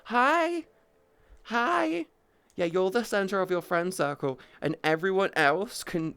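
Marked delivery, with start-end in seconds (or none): 0.0-2.0 very high, quick little hellos; squeaky hi. Real, not acted. Streamer greeting new chatters, very high and light. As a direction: pop out two tiny his.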